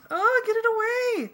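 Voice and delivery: very high, loud shriek; frantic high-pitched wail; panicked scream. A narrator's reading. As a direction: scream for someone to get it off. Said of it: "Darlene screamed".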